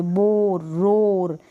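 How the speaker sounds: "बोर, रोर" with a long open aw; long vowel. Shown as a demonstration. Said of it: "अ का sound थोड़ा लंबा".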